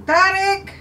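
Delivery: high call to another room; yelling across the room. Real, not acted. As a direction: call his name across the house. Calling husband from another room.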